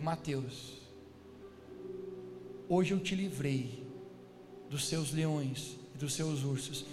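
low, measured, solemn voice; God. Retold or imitated. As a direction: speak down with grave authority. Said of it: "Deus fala comigo". (voz grave e solene, pausada)

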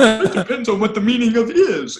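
high and loud with laughter; laughing. Real, not acted. Host finishing the Clinton joke, laughing. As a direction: quote it while cracking up.

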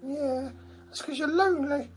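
squeaky high cheeky dog voice; dog's voice. Pretend. Voicing the dog.

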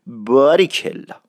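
باریکلا with long a and long i; stretched vowels. Shown as a demonstration.

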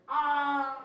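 high, loud yelp of a fleeing man; high loud scream of a man jumping into the dark; retold scream. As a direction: fling out a sudden shriek as he vanishes. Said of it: "disappears into the darkness screaming like".

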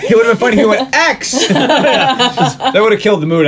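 high, laughing aside; giggling through the joke. Real, not acted. Host jokes about the movie scene, laughing.

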